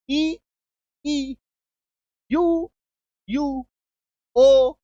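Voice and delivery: high, drawn-out vowel names, each apart; teacher sounding out long ई, यू, ओ one by one; long vowel demo. Shown as a demonstration. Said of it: "long vowel sound का एक trick है".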